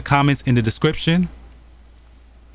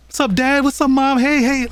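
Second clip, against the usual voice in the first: put-on happy kid voice; 14 semitones higher than usual. Voicing a happy child greeting.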